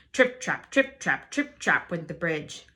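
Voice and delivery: ordinary delivery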